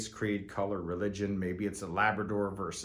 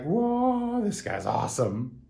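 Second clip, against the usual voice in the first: imitated wowed client; 8 semitones higher, 4 dB louder, slower than usual. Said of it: "they're gonna be like".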